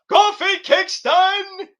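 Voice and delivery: congratulatory high, drawn-out shout; big mock-announcer holler; hyped name call. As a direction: belt out the name like a ring introduction.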